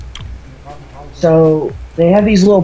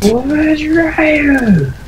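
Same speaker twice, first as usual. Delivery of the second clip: high, slow drawn-out callout; announcer voice; 13 semitones higher, 3 dB louder, much slower than usual. Pretend. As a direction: stretch it like a game announcer. Friend voices game announcer callout.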